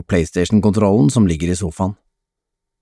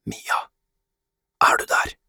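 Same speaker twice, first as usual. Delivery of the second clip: hushed whispered call; 7 dB quieter than usual. A narrator's reading. Adam whispers for hiding Mia in the living room, whispering. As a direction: whisper it, barely any voice. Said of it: "hvisker Adam".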